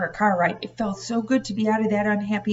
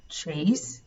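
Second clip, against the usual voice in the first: warning; 2 semitones lower than usual. A narrator's reading. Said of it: "Grandma warned".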